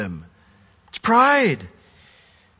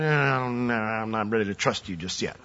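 softer, lower, muttered doubt; a mock inner voice, softer and lower, unwilling to trust; distrustful mutter; 5 semitones lower, 8 dB quieter than usual. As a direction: grudgingly hold back trust under your breath.

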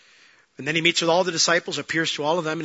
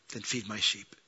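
much softer and lower, tender; gracious reply; 7 semitones lower, 10 dB quieter than usual. Retold or imitated. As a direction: forgive him with a hush. Lord graciously answers Peter.

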